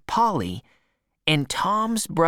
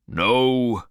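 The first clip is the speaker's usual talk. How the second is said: put-on lower, gruff old husband; old man's voice; 5 semitones lower, 3 dB louder than usual. A narrator's reading. Old man answering his wife, lower. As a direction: answer like a worried old farmer.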